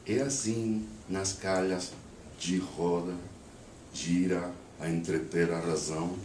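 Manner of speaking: poem recited in Brazilian-accented Portuguese, phrase by phrase. Shown as a demonstration. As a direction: recite with a Brazilian accent, pausing between verses. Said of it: "read the poem with a Brazilian accent".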